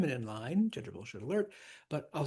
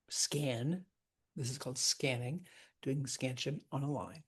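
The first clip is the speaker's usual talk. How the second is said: ordinary talk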